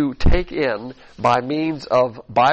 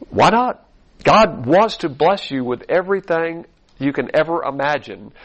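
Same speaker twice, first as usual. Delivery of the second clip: ordinary delivery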